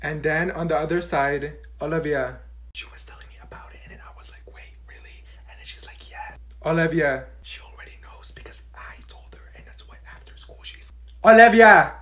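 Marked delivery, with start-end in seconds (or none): 2.7-9.5 fast unvoiced gossip whisper. 9.6-11.9 whisper shout. Acted. Whisper bursts loud.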